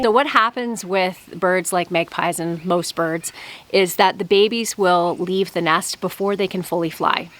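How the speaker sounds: ordinary delivery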